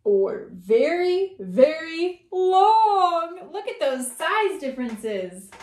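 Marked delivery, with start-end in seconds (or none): 0.0-3.5 very slow, drawn-out, rising louder; stretched long. Acted. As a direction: pull "very long" out as long as you can.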